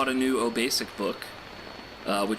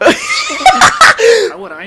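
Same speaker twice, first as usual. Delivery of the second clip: laughing shout; 18 dB louder, much slower than usual. Acted.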